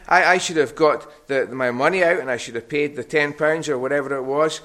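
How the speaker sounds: ordinary talk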